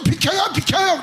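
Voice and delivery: high, loud panicked yell; yelling skier. Retold or imitated. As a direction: shout them out of the way while hurtling downhill. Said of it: "장로가 돼도".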